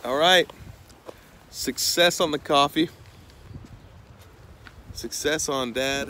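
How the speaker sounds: excited. Real, not acted. Camper cheering as the morning coffee comes out, excited. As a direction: celebrate the coffee out loud.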